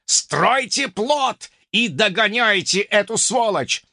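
slow, drawn-out snarled command; an unknown player snarling from the beach; growled order. Acted.

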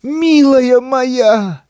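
high, slow tearful wail; tearful cry. A narrator's reading. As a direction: cry out through tears. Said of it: "проливая из глаз ручьи слёз".

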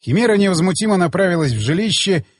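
usual speaking voice

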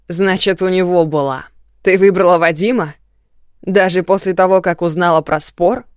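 громкий, яростный допрос; наседай на неё со злостью; злой крик. Acted. Муж в ярости допрашивает жену. (злобный крик)

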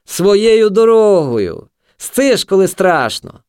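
high, very drawn-out yell; stretched high-pitched jeer; mocking shout. A narrator's reading. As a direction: echo him in slow loud mockery. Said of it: "крикнув шмаркач".

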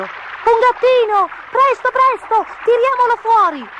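very high, loud urgent cries; high-pitched storybook children's alarm; excited children shouting. A narrator's reading.

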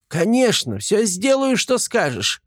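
high, squeaky eager promise; eager gremlin. Acted. Gremlin promising Artyom obedience, high eager voice.

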